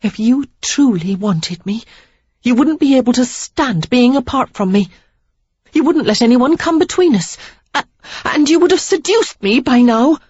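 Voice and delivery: put-on young woman's voice rising to a heated peak; Sarah, accusing. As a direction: throw it in his face. Sarah's lines, higher voice.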